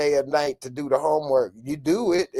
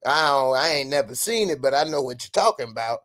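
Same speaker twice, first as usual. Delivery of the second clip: ordinary delivery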